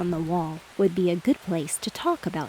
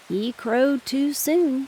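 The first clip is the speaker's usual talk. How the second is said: higher, slow, stressing soon; slow moral line; 6 semitones higher, much slower than usual. Acted. Drake sums up the lesson slowly. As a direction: draw it out like a moral. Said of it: "said the drake".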